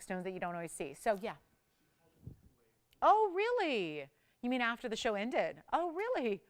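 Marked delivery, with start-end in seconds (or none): 3.0-4.1 high, slow, louder oh really; surprised. Real, not acted. Reacting to news from producer. 5.7-6.4 surprised. Real, not acted. Second surprised reaction.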